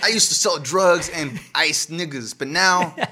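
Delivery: loud, high, slow mock testimonial; hammed-up ex-gangster spokesman voice; former gangbanger. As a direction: parody a reformed gangbanger in an ad. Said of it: "former gangbang".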